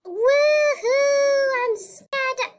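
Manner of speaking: very high, loud thrilled squeal; excited squeal. Real, not acted. Gamer on the trampoline squeals with excitement. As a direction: squeal with fun and fake fright.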